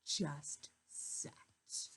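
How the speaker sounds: soft, breathless whisper. A narrator's reading. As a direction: whisper it like a secret. Narration whispered.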